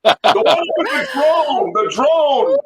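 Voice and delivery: loud, high, laughing shout for the drone clip. Real, not acted. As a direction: yell the clip name over the chaos. Directing co-host to right clip.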